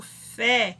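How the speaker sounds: FÉ set apart as the strong syllable; stressed syllable. Shown as a demonstration. Teacher answering which syllable is strongest, stressed FÉ. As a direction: isolate "fé" and lean on it. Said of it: "Qual que é a sílaba mais forte?".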